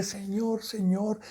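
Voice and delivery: quiet plea. Retold or imitated. Retold plea to God.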